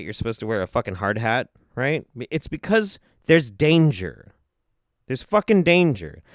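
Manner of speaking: normal talking voice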